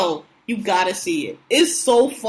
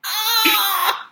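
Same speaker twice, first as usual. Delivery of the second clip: laughing shriek; 8 semitones higher than usual. Real, not acted. Laughing at the scene.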